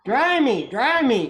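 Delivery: put-on high, loud baby cry; soggy baby chick begging loudly. A narrator's reading. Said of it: "cried the soggy baby chick".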